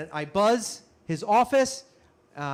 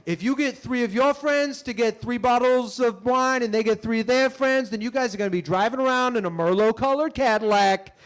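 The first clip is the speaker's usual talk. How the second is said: loud, higher-pitched salesman spiel; pyramid-scheme pitch; 5 semitones higher, 5 dB louder than usual. Acted. Comic mimics a wine pyramid scheme recruiter, loud sales pitch. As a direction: sell it hard in one long run.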